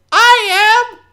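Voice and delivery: high, loud squeaky goblin cry; eager little goblin calling out; young goblin shout. Acted. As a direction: yell it from the back in a tiny goblin voice. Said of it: "this young goblin".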